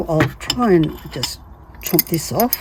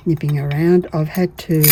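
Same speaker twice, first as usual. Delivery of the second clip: ordinary delivery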